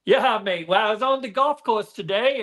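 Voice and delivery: a hammed-up Aussie "Yeah, mate"; Aussie accent. Asked by the host for his best Aussie accent, a mild hammy Australian accent. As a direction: drop into a cheesy Australian accent. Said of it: "give me your best Aussie accent".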